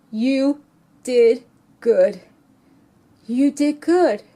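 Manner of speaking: slow, tearful, breaking near tears; barely holding it together as she repeats it; real tears welling up in the voice; choked up. Real, not acted. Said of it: "I was in tears".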